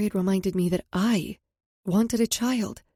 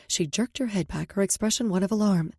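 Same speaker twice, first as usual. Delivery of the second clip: ordinary delivery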